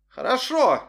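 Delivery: bright fake reassurance; fake cheerful. A narrator's reading. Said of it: "соврал Арсений".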